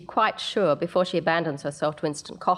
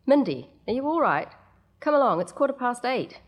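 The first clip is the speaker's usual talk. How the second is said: mother calling through door; 7 semitones higher than usual. Acted.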